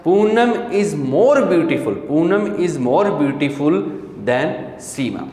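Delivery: very slow, word by word; slow classroom dictation. Shown as a demonstration. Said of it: "teacher dictates slowly".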